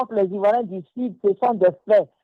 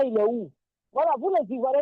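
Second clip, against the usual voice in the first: ordinary talk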